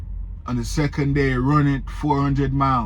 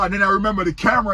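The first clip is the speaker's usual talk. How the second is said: ordinary delivery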